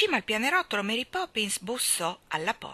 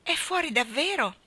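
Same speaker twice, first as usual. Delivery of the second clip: higher, let-down question; disappointed; 8 semitones higher than usual. Acted. Jane asking disappointed if he is really out. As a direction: ask hoping it's not true. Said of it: "disse Jane contrariata".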